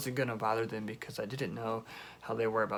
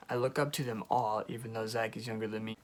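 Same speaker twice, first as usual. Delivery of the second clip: ordinary talk, nothing marked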